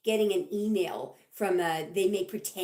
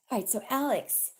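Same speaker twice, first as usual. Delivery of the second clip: ordinary talk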